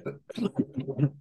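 short dry cough; coughing fit. Real, not acted. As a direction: cough into the mic. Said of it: "Sorry for all this coughing today".

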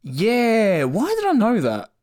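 high, loud surprised yelp. Real, not acted. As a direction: sound amazed at yourself. Surprised at own knowledge.

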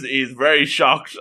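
ordinary talk

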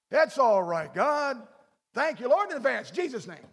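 breezy thanks. Retold or imitated. Mock casual prayer.

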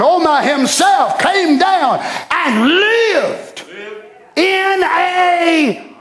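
impassioned pulpit delivery; rhythmic preacher building to long held cries; preaching. Acted. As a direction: drive the scripture home, stretching the climactic words. Preaches at full force.

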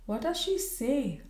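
husband nervously asking about his wife; trembling. A narrator's reading. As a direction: ask with a frightened tremor. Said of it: "asked the Colonel, trembling".

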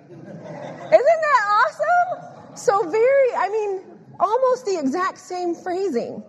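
high-pitched, laughing excitement; giddy with discovery; thrilled. Real, not acted. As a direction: marvel at it, stretched out and high, with laughter. Delighted at the matching word found.

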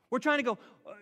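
ordinary talk, nothing marked

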